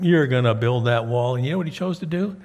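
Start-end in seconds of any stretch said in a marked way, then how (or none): 0.0-1.4 a hint of scornful mockery, a bit louder; mocking. Teacher voicing the three naysayers jeering at Nehemiah, lightly mocking. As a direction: sneer it like the naysayers. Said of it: "They just mocked him".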